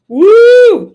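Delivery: high loud whoop; excited whoop. Real, not acted. Cheering for libertarian caller.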